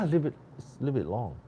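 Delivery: ordinary talk, nothing marked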